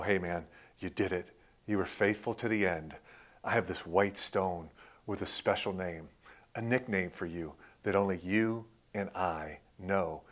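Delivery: low, soft voice of God to a friend; hushed, tender quoted praise; intimate retold reassurance. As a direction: speak gently, as if confiding. Voices God speaking intimately to believer.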